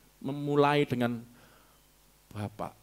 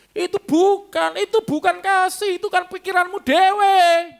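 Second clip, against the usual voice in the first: much higher, louder and slower, hammering each word; emphatic preacher shout; 12 semitones higher, 7 dB louder, slower than usual. Acted. Preacher rebuking the congregation's idea of love, loud and high. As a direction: thunder it down at the congregation.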